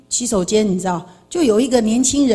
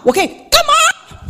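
shrill scream. Retold or imitated. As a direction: shriek it out like an angry mother. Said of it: "突然可以这样尖叫".

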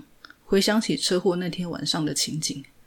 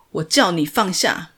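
louder barked command; shouted order; 7 dB louder than usual. A narrator's reading. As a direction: order him to drop it. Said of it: "警察大喊着". (大声喝令)

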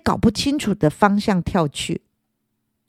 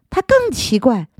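ordinary delivery, nothing marked

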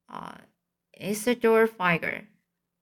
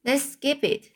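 a quick, higher snap at the class; snapped; 6 semitones higher than usual. A narrator's reading. Stuart to the class, a short higher snap. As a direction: brush it off sharply and fast. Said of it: "snapped Stuart".

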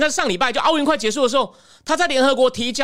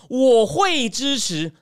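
very slow, dragged-out, reluctant 我会支持; hesitant mumble; much slower than usual. Retold or imitated. Intelligence chief stalling before Putin, slow and hesitant. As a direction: stretch 我会支持 out like a cornered official. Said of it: "你还在那边给我吞吞吐吐说".

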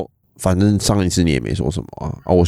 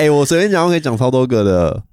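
excited; 6 semitones higher than usual. Real, not acted. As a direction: jump in eagerly. Eager to list ex's merits. (兴奋地抢话)

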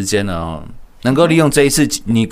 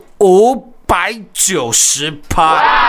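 excited shout; 6 semitones higher, 3 dB louder, much slower than usual. Acted. Shouted climax of pitch.

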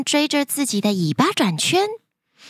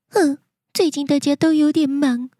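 upset, near tears; 7 semitones higher, faster than usual. Acted. Elf about to cry.

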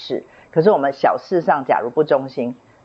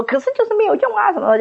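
high, fast mock complaint; mock whine; faster than usual. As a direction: whine it like a discouraged girl. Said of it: "他的意思说".

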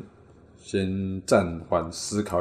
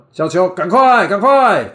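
小球，赶快，赶快 much higher and louder; urgent hurrying call; 12 semitones higher, 12 dB louder, slower than usual. Shown as a demonstration. Husband showing how he urges the child, loud high call. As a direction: hurry the child along, calling out. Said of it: "催促的".

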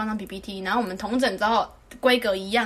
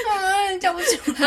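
higher, whiny mock voice, half laughing; mocking whiny classmate; 9 semitones higher, 3 dB louder, slower than usual. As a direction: whine the excuse like the classmate. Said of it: "他就说". (学同学的委屈语气)

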